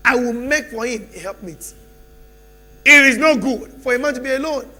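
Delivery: loud, rising preacher calls with long pauses; preaching at full force. Acted. Preacher rousing the church with loud calls. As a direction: pound each phrase out to the church.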